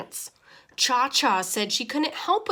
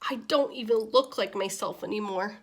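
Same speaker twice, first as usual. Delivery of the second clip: usual speaking voice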